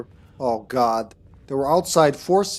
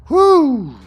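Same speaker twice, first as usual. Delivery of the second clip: high, loud triumphant whoop; relieved cheer; 14 semitones higher, 10 dB louder than usual. Real, not acted. Escaped the monster.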